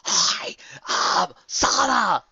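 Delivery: slow, high, breathy shout in the ear; hiss-shout it into her ear; shouted whisper. A narrator's reading. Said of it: "shouted at the top of her voice".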